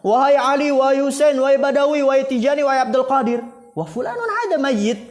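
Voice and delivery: high, loud string of appeals; imitated loud calling. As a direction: cry out each name as loud as you can. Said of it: "dengan suara-suara mereka yang paling tinggi".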